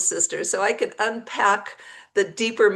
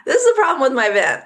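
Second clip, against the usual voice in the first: louder and faster, insisting it's the vent; insisting loudly; 4 semitones higher, 7 dB louder, faster than usual. Retold or imitated. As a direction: push back hard at Larry, quick and loud. Insisting to mechanic Larry.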